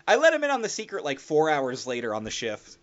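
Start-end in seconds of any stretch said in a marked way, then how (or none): none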